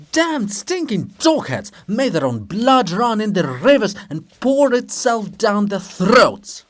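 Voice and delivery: high furious shouting curse, slowing down; menacing and loud. Acted. Shevchenko rages at the enemy, menacing loud shouting. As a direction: roar the curses with menace, dragging the end. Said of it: "Shevchenko, menacing and loud".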